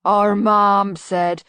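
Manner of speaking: slow, loud, lumbering big troll-man voice; a hulking simpleton, slow and loud; deep voice. A narrator's reading. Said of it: "said a deep voice".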